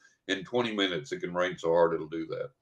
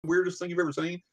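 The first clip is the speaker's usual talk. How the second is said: amazed; 11 semitones higher than usual. Retold or imitated. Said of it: "and I go".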